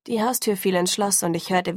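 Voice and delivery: plain reading voice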